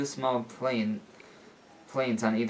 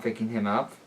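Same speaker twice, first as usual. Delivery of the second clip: usual speaking voice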